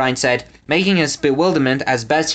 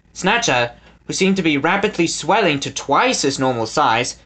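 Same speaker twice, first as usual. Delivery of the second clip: ordinary delivery, nothing marked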